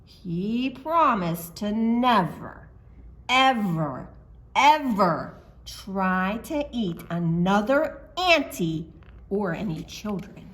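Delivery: plain reading voice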